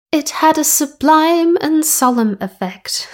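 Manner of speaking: posh voice slipping back toward plain; failed posh voice. Acted. Podcaster's posh Queen voice slips mid-line. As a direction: try for posh but lose it. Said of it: "didn't stay in the posh voice I thought".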